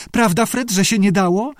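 high squeaky appeal; squeaking. A narrator's reading. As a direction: plead for backing in a high voice. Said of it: "pisnęła Judy".

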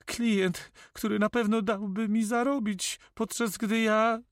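high-pitched, complaining notary; babbling notary. Acted. Notary complains about losing his earnings, high-pitched.

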